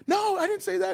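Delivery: retold girl backpedalling in a high mock voice; mock girl's protest. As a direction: play her defensive denial up high. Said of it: "she goes".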